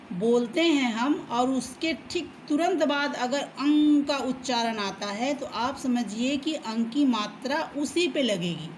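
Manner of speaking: ordinary talk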